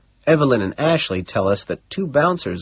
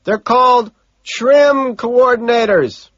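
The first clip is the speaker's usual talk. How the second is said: slow, high, loud yell; shouted explanation over noise; shouting; 14 semitones higher, 7 dB louder, slower than usual. A narrator's reading. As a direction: holler it with each word stretched. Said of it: "he shouts".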